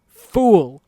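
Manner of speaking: loud, high cry of fool; shouted. A narrator's reading. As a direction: hurl the insult as a yell. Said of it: "Soego cried".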